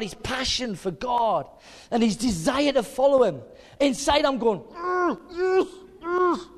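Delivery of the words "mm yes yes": high, muffled inward yeses; imitating a stifled, unmoving-mouth response; mouth not moving. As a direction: squeal it through closed lips. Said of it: "my mouth's not moving".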